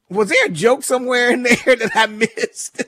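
puzzled and tickled, laughing as you ask; amused bafflement. Real, not acted. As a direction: ask it through a rising laugh.

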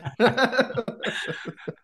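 a loud, high burst of laughter; high delighted laugh. Real, not acted. Host laughing at the Interstellar memory, loud and high. Laughs after remembering episode.

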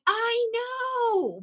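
high, thrilled agreement; excited. Retold or imitated. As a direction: exclaim it like happy news. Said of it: "I'm like".

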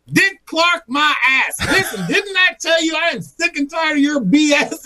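very high, shrieked tirade; furious wife. Retold or imitated. Wife tells off the supposed prankster, furious high yelling. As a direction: rant at full tilt in a high voice. Fed-up wife yells before hanging up.

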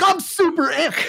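mocking a forced, overexcited caller; fake hype. Salesman mocking a fake-pumped cold caller, hammed-up hype. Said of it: "don't fucking call somebody and be like".

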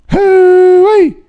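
loud, high whoop of delight; excited holler. Real, not acted. Host whoops loudly, delighted with a caller's opinion. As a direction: let out a big country hoo-wee.

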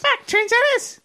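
put-on very high, slow child squeal; little girl voice. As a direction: squeal it like a toddler. Mimicking the child's swear.

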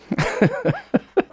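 very high giggling burst; high laugh. Real, not acted. Laughing after the voice.

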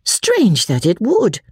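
high, drawn-out cry; exclaiming. A narrator's reading. Marianne crying out to her family in protest, exclaiming. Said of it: "cried Marianne".

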